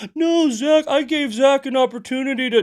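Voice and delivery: mock him in a squeaky sneer; mocking whiny voice. Mocking opponent's words.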